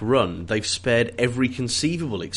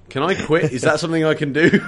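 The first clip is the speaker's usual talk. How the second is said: higher, louder comic plea; pleading to quit; 4 semitones higher, 5 dB louder than usual. Acted. Worker asking foreman to let him quit, comically pleading. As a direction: look desperately for an exit. Acted worker wants out of the job.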